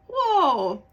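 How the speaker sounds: high, loud startled whoa; a big startled whoa; surprised whoa. Retold or imitated. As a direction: gasp it out at the late hour. Noticing how late it is.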